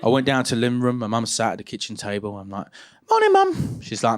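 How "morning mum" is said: much higher, too-bright greeting; overly innocent hungover teen; fake innocent.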